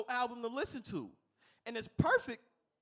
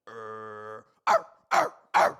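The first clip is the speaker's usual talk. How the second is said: dog bark imitation; 9 semitones lower, 12 dB louder, much slower than usual. Comic at the drive-thru barking like a dog into the intercom. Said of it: "I went".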